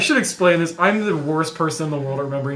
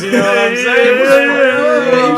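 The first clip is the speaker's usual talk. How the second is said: laughing; 6 semitones higher, 8 dB louder, much slower than usual. Acted. Joking insinuation with laugh.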